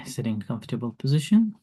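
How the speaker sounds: soft, calm meditation guide; calm grounding exercise. Acted. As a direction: guide them gently into the body. Leads meditation.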